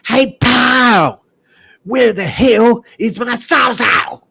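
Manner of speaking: high, loud yelling dad voice, slow on the second line. Retold or imitated. Dad catching son after the pawned Sawzall, high angry yell. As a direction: shout it like an outraged father hunting his saw. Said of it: "he came into my room and said".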